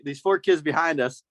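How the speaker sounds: ordinary talk, nothing marked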